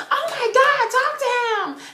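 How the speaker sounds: high, loud mock yell; exasperated urging. Friend urging scared fan to talk, exasperated. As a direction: imitate a friend shrieking the order.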